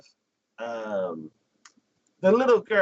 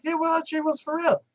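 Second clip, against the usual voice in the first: put-on high, insistent kid voice; insistent girl. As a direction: argue back like a kid.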